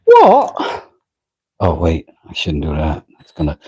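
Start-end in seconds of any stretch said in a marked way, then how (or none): 0.0-0.9 very high, loud outraged cry; shocked wife. Retold or imitated. Imagining the wife's reaction to being told, a loud shocked cry. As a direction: shriek the word like a scandalised wife. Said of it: "She's gonna be like".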